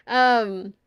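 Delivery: ordinary delivery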